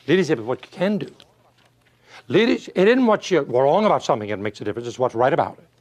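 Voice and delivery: normal talking voice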